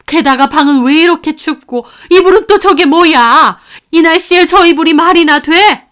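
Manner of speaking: loud, high furious grandmother scolding her son; angry scolding. Acted. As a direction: tear into the son at full volume. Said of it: "저는 아들을 불렀습니다". (아들을 호되게 꾸짖는 분노한 고함)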